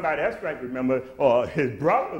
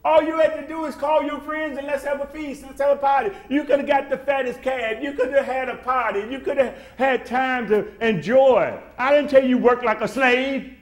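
father pleading warmly; 10 semitones higher, 5 dB louder than usual. Retold or imitated. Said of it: "His dad said".

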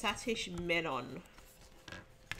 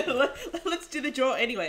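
laughing; 7 semitones higher, 9 dB louder, faster than usual. Real, not acted. Laughing while talking.

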